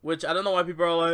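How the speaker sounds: ordinary talk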